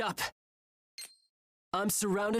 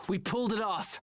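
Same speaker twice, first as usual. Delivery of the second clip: ordinary talk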